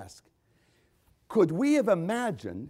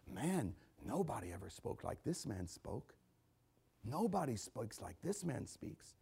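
very soft, lowered voice, slowing at the end; hushed awe; 6 semitones lower, 13 dB quieter than usual. Acted. Men sent to arrest Jesus reporting back, hushed and awed. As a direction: say it under your breath in awe.

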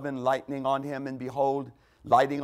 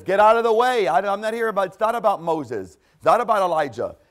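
loud, higher, emphatic burst as God; God interrupting; 5 semitones higher, 6 dB louder than usual. Acted. God brushing aside talk of Moses and Elijah, loud interruption. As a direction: cut in forcefully, loud at the start then easing. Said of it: "God says".

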